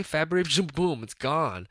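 normal talking voice

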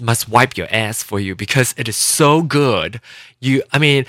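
ordinary talk, nothing marked

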